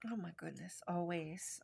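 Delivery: soft unvoiced whisper of wonder; whispered delight. Real, not acted. Teacher notices a little heart in the paint, whispering. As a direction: breathe it out in a hushed whisper.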